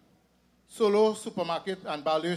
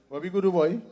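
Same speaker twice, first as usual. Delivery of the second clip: imitating a thick Indian accent, louder; mock Indian accent, raised voice; 6 dB louder, faster than usual. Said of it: "with this Indian accent".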